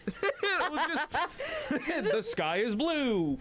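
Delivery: laughing through the words, slowed down; can barely get it out for laughing; giggly, high and slow. Real, not acted. Laughing while joking.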